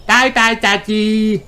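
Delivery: high, slow bye-bye; cheery cry. Acted. Children call goodbye to tired Daddy. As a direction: wave him off gleefully. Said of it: "cry Peppa and George".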